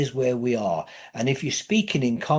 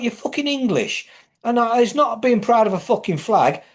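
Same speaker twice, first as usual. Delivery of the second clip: ordinary talk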